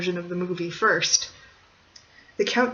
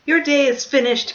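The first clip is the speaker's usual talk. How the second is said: loud, high defiant declaration; heroic defiance; 6 semitones higher, 8 dB louder than usual. Acted. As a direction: throw it at the villain. Hero defies Count.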